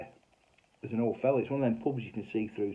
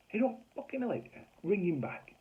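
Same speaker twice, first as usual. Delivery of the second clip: very high-pitched, incredulous repeat; outraged echo; 10 semitones higher than usual. Retold or imitated. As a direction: mimic the landlady's indignant disbelief.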